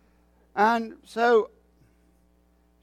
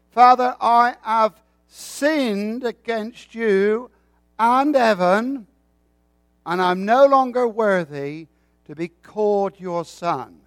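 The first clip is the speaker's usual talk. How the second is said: slow, earnest plea, a little louder; humble confession; 3 semitones higher, 6 dB louder than usual. Acted. Son begging his father's pardon, slow and earnest. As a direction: slowly pour out the son's sorrow.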